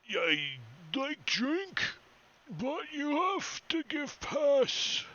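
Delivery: very slow, high, loud dim ogre. Acted. Ogre at the well wants a pass before drink, slow dim ogre. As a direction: draw it out like a slow-witted ogre. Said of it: "He looks at you and says".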